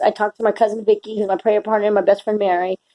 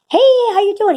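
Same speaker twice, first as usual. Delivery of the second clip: bright, high, louder hello; cheery stranger's greeting; 13 semitones higher, 5 dB louder than usual. Retold or imitated. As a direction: call it out like a beaming stranger. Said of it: "and he says".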